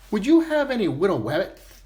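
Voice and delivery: put-on "widow wabbits" with w for l and r, childlike; "widow wabbits" as a kid mixing up his l and r; speech impediment. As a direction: lisp "widow wabbits" like a small boy. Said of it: "he had a speech impediment".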